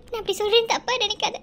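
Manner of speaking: normal talking voice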